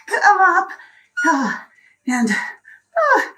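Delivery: high, loud, laughing strain; strained effort. Real, not acted. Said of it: "this is a lot of effort".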